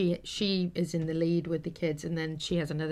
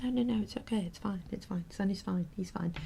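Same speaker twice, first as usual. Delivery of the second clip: reassuring; 3 semitones higher, 5 dB quieter than usual. Retold or imitated. Telling the teacher the horse is fine, softly reassuring. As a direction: gently soothe a worried teacher.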